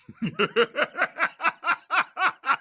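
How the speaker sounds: high, wild villain cackle; unhinged villain laugh. Shown as a demonstration. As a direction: let loose a mad evil cackle. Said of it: "an evil villain laugh".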